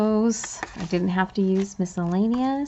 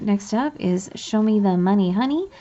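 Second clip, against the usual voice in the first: ordinary talk, nothing marked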